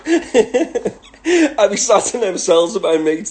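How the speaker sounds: slow, high laughing recollection. Real, not acted. Telling viewers about prison cells with mates, laughing. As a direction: laugh as you remember it.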